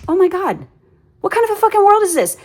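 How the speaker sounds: high, fast, exasperated rant; outraged rant. Real, not acted. Vlogger venting about line-cutters, high outraged rant. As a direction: let the indignation push the voice up high. Ranting about rude people.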